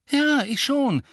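high, loud insistence; instant, emphatic. A narrator's reading. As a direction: jump in defiantly. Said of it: "sagte Harry sofort".

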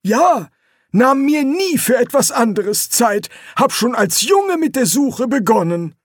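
put-on a fussy old explorer; old Quirm. A narrator's reading. Quirm continues. (viel höhere Greisenstimme)

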